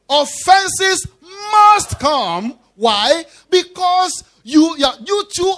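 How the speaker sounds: loud high "Offenses", loud "must come"; fervent sermon shout; preaching shout. Real, not acted. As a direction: raise it up like a preacher at full force.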